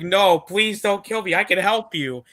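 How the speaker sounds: mock begging. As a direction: beg for your life, hammed up. Recap voicing Vario begging for life.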